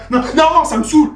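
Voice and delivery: loud, high burst of exasperation; mock losing temper. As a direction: snap out of staying calm, fed up. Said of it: "y a pas besoin de s'énerver".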